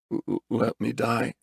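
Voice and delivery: slow, soft, stammering murmur; weak murmur. A narrator's reading. Injured man to the surgeon, murmuring weakly. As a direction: murmur it slowly, barely getting it out. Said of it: "was all he could murmur to the surgeon".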